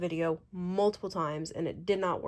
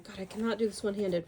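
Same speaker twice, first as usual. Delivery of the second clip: usual speaking voice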